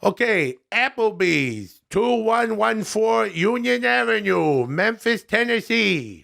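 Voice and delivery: high, slowly drawn-out comic announcement; Adam Sandler. Acted. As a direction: proudly ham up the review, stretching the words. Voices Adam Sandler's reviews.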